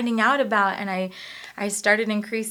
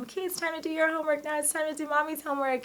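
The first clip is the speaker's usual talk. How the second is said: put-on higher, fast, sing-song mom talking to kids; cheery mom voice; 8 semitones higher, 4 dB quieter, faster than usual. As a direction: chirp it like a mom rallying kids. Mom voicing herself to kids.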